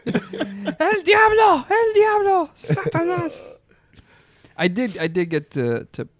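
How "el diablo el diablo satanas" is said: high, slow, laughing cries of horror; mock horror. Spooked parents watching kids crawl backwards, high slow mock horror. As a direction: wail it as if seeing the devil.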